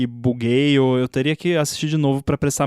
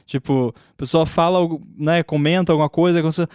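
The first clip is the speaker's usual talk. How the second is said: ordinary talk, nothing marked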